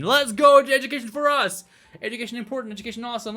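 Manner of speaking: loud, high rallying shout; hyped cheer. Pretend. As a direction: cheer the news loudly. Cheers education news.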